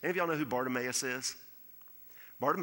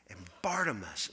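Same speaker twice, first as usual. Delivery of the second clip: hushed; 6 semitones lower than usual. Acted. Hushed dramatic pause in sermon.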